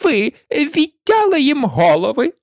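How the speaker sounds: high-pitched imperious cry; queen's piercing call; shouting queen. A narrator's reading. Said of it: "закричала королева".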